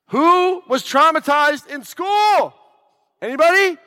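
loud, high, slow question flung at the room; calling out to crowd. Real, not acted. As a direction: call it out to the whole hall, drawn out. Calling out to the audience.

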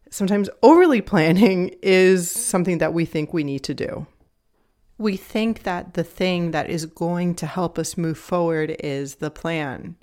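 normal talking voice

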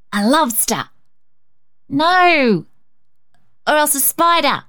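put-on high, matter-of-fact boy voice; literal little boy. A narrator's reading. Cecil naming the blot to Rhonda, high stubborn boy voice. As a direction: insist stubbornly. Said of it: "persisted the literal Cecil".

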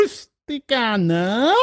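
high, slow rising Italian flourish on Rusticana; fake Italian accent. Shown as a demonstration. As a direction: stretch Rusticana out with a lilt. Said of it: "I go".

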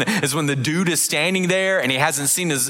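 ordinary delivery

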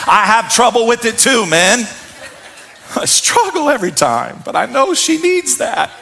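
chuckling through a rueful admission; laughing confession. Real, not acted. Pastor admitting to the church his card struggle, laughing. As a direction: laugh at yourself about the cards. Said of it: "laughing confession".

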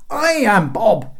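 high, loud, slow declaration; yelling. A narrator's reading. Bob unrecognised by the guards, yelling. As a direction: bristle and yell each word. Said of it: "he yelled".

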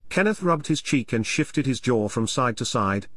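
ordinary delivery

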